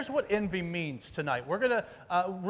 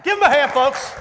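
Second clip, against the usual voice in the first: very loud, high shout for applause; big emcee holler over applause; calling out; 9 semitones higher, 17 dB louder than usual. Real, not acted. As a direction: shout to rally the crowd. Rallying applause for volunteer.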